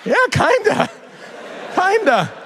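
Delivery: very high, squeaky concession; helpless agreement. Acted. Parent admitting the boy is right, squeaky. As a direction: let the voice leap up as you concede. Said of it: "I'm like".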